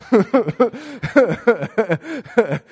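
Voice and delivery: warm full laugh; real laugh. Real, not acted. Storyteller laughing while telling the viewers. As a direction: laugh freely at the memory.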